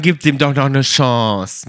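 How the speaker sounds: slow sample line, Chance as nasal "shons"; own French-style pronunciation. Shown as a demonstration. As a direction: Chance without any NG, French vowel. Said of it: "Also ich würde immer sagen".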